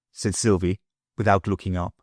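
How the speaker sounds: ordinary delivery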